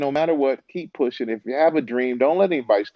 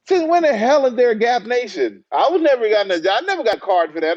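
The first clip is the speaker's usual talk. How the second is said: high, incredulous retort, played for comedy; mock indignation; 9 semitones higher, 4 dB louder than usual. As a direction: protest in comic disbelief, voice pitched up. His indignant retort to fans.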